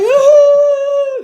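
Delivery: a very high, loud long ooh; emotional ooh. Retold or imitated. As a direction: cry out at seeing the baby. Baby comes out.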